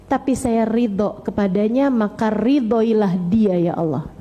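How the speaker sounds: heartfelt low prayer voice; earnest prayer. Acted. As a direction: pray humbly for your child. Models the prayer for mothers.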